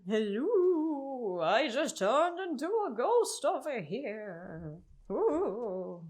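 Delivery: slow, eerie ghost voice. Acted. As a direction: wail it like a spooky ghost. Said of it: "That's my ghost voice".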